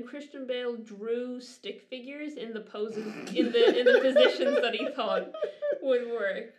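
can't hold back laughing while telling it. Real, not acted. Laughing at the stick figures.

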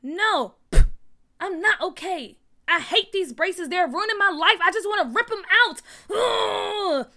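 loud, high, worked-up rant; angry outburst. A narrator's reading. Nathaniel rants at his mother about braces, angry shouting. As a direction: explode with frustration, ending in a loud groan. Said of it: "Nathaniel said angrily".

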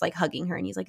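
normal talking voice

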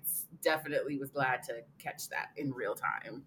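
ordinary talk, nothing marked

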